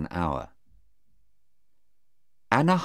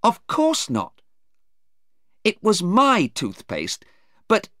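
high, indignant protest; angry; 5 dB louder than usual. A narrator's reading. Sarah insisting on the truth to the lawyer, angry. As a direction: protest hotly on a raised pitch. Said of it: "Sarah said angrily".

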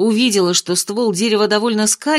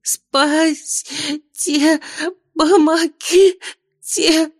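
higher, slow crying plea; panicked cry for help; 9 semitones higher, much slower than usual. A narrator's reading. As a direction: scream for help while sinking. Girl dragged under ground.

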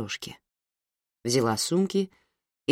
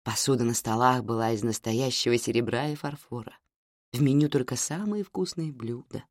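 plain reading voice

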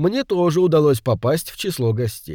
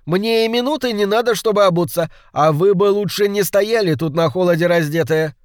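high, urgent, raised voice; calling out; 7 semitones higher, 3 dB louder than usual. A narrator's reading. Suspect hurries to leave the group, raised urgent voice. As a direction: rattle off the excuse emphatically. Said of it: "крикнул Леверинг".

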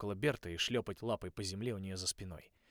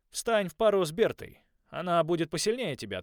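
commanding; 7 semitones higher, 8 dB louder than usual. A narrator's reading. Coach giving orders.

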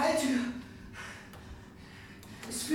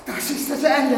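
despairing cry; 2 semitones higher, 10 dB louder than usual. Acted. Believes the blast is coming.

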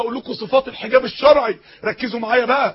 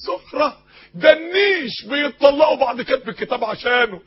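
ordinary talk

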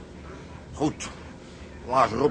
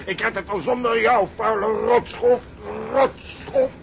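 raised, scornful tirade at a deserter; furious outburst; 6 semitones higher than usual. Acted. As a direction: spit the insults out in rage. Furious quarrel with partner. (woedend en hoog, scheldend)

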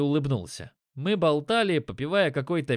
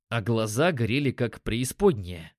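usual speaking voice

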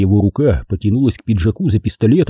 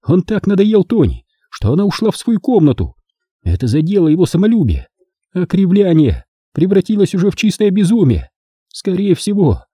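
higher, bitter woman's confession; Naomi confessing; 8 semitones higher than usual. Acted. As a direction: tell it like a guilty woman unburdening herself.

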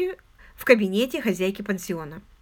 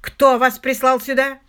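loud, slow, raised-pitch threat; ominous, forceful interrogation; menacing demand; 4 semitones higher, 9 dB louder than usual. A narrator's reading. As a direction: demand it like a fury. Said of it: "зловеще кривя губы".

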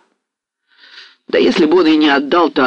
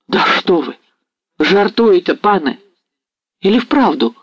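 higher, eager disbelieving exclamation; excited cry; 6 semitones higher than usual. A narrator's reading. Ostap leaping from his seat, crying out in disbelief. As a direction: jump up crying out in hope. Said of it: "вскрикнул Остап".

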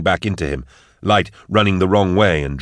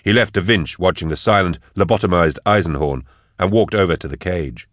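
ordinary delivery, nothing marked

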